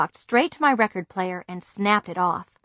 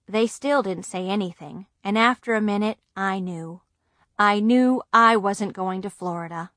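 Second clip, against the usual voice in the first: ordinary delivery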